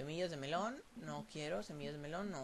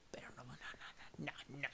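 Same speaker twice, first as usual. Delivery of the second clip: susurro; 3 semitones lower, 10 dB quieter, much faster than usual. Real, not acted. Niega susurrando.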